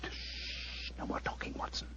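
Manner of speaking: urgent whispered hush; whispered shush. Acted. Holmes silencing Watson during the vigil, whispered hush. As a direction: cut him off with a breath.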